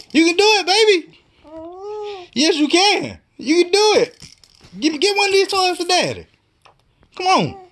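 high, excited coaxing; cheering. Real, not acted. Dad cheering baby Shannon on.

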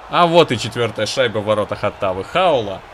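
loud triumphant goal announcement; excited hockey goal call. Real, not acted. Goal call after a miss.

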